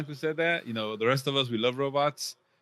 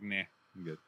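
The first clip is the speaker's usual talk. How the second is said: dismissive; 6 semitones lower, 11 dB quieter, faster than usual. Retold or imitated. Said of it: "and I was like".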